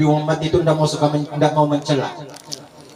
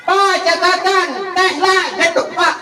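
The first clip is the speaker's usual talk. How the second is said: very high, loud, shouting the shopping list; wife shouting reminders to her forgetful husband; shrill nagging wife; 4 dB louder, slower than usual. Retold or imitated. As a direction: screech the list after the husband.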